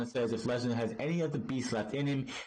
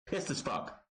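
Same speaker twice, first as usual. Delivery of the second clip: deadpan emphatic repeat; 4 dB quieter than usual. Shown as a demonstration. Vlogger echoing his own recap line, "Pissed as fuck" in a softer deadpan.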